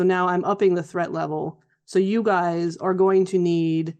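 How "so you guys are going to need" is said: ordinary delivery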